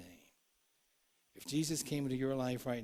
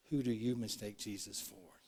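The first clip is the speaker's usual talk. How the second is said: normal talking voice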